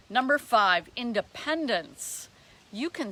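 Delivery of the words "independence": normal talking voice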